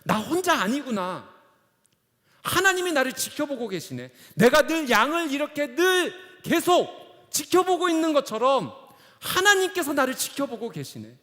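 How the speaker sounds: high, loud preaching cry; fervent realisation. Acted. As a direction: proclaim it at full force as David. Preacher acts out David's fervent realisation.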